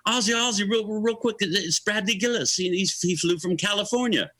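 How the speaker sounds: high-pitched imitation of an eager introducer. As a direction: get his attention, pitched well up. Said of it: "And Sharon goes".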